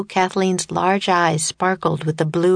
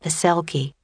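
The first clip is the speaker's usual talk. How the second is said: ordinary delivery, nothing marked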